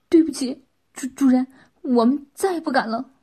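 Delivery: loud, stammering plea for forgiveness; cowed apology. Acted. The pair apologise to their master, cowed and stammering. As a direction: stumble over the first words, begging pardon.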